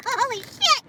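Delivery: normal talking voice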